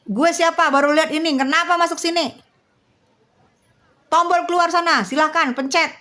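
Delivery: high-pitched, indignant demands; angry confrontation. Real, not acted. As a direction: drive the intruder out of your room. Confronting an intruder on stream.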